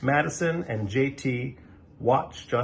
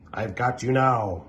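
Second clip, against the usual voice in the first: alien voice, lightly put on; a triumphant alien in battle; space alien.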